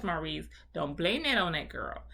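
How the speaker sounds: ordinary delivery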